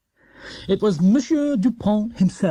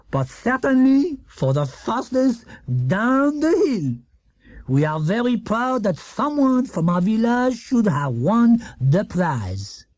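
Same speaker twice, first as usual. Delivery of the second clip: French mayor speech; 5 semitones higher, slower than usual. A narrator's reading.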